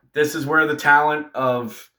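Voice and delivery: usual speaking voice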